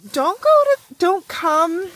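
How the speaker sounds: high loud plea; pleading warning; urgent warning. Real, not acted. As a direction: warn them off, urgently. Warning viewers about cold.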